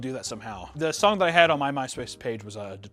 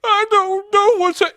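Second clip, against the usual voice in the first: much higher, louder wailing crybaby; mock sobbing Rodeo; 9 dB louder than usual. Rodeo missing her son, mock high sobbing wail. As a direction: squeal it out like an ugly cry. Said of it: "she's like".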